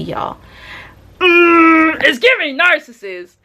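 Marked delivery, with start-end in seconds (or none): 1.2-3.3 high mock-proud hum; self-teasing. Real, not acted. Watching herself on TV, high teasing. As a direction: tease yourself on screen. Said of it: "I'm watching myself on the T V".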